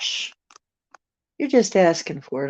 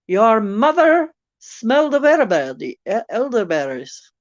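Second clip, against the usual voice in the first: put-on silly French accent; 6 semitones higher, 5 dB louder than usual. Quoting a film insult for viewers, silly French accent. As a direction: fake French accent, high and loud. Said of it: "can I do the silly French".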